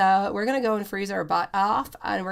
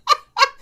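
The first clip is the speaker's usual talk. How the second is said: short high laugh; 8 dB louder than usual. Real, not acted. Laughing at viewer comment.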